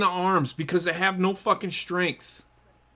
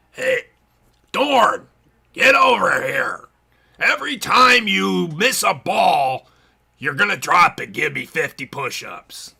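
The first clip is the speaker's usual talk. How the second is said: slow, louder barking coach order; a lightly voiced team manager calling a player over; hammed-up baseball manager giving orders; gruff manager voice; 4 semitones lower, 3 dB louder than usual. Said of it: "I can do the voice, and I'm gonna do the voice".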